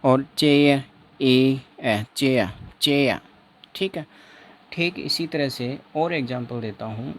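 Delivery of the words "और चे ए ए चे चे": "चे" stretched and slow, no r; drawn-out demo. Shown as a demonstration. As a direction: sound out "चे, ए" slowly for the class. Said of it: "इसका उच्चारण क्या हो जाएगा".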